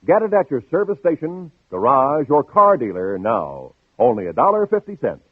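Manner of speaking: ordinary delivery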